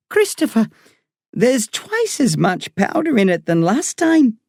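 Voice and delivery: put-on Irish woman doctor talking to a boy patient. A narrator's reading. Doctor voiced in story.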